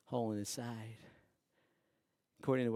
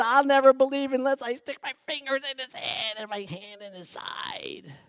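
stubborn refusal in a put-on doubter's voice; doubting Thomas; 13 semitones higher, 12 dB louder than usual. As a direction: Thomas swears he won't believe. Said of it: "he said those famous words".

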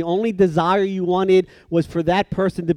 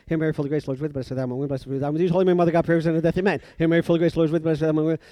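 gabbled at top speed, words running together; rushed rote prayer; much faster than usual. Retold or imitated. Kid knocking out twenty penance prayers, gabbled fast. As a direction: race through it mechanically without pausing. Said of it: "I knocked those twenty prayers out".

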